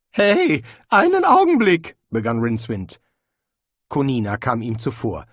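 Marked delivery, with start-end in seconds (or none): 0.1-1.9 much higher, louder startled cry; shrill panicked interjection; alarmed protest. Acted. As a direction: shout to stop them in a panic.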